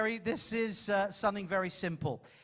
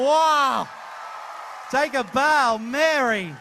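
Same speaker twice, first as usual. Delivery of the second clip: louder, cheering the volunteer; delighted praise; 4 semitones higher, 9 dB louder, much slower than usual. Real, not acted. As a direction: hype her up.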